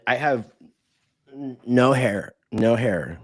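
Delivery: ordinary talk, nothing marked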